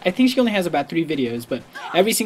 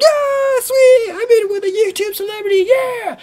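very high, louder starstruck squeal; fanboy squeal; 6 dB louder than usual. Retold or imitated. Fan bragging to his lobby about the YouTuber, very high squeal.

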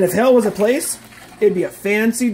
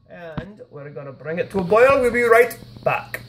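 ordinary talk, nothing marked

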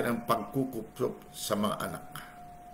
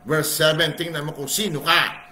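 ordinary talk, nothing marked

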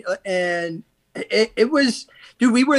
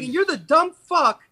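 higher, mock outraged telling-off; incredulous anger; 7 semitones higher than usual. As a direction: scold him in disbelief. Berating the inmate.

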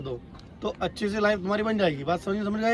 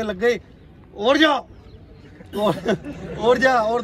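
mock scolding parent, louder; strict old-time parent; 5 dB louder, much slower than usual. Coach mimics old parents scolding a hurt child, mock loud scold. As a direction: bark it like a stern father shooing the kid off. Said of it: "पहले के माँ बाप ऐसे थे कि".

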